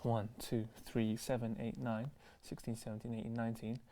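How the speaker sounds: very low, barely voiced count; counting quietly. Real, not acted. Host counting up the comments, very quiet and low. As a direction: count under your breath.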